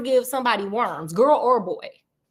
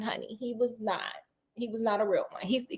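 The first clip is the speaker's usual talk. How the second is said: dropped low and soft, nearly a whisper; hushed aside; 3 semitones lower, 12 dB quieter than usual. Real, not acted. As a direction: lean in and confide it quietly. Aside about the disloyal friend.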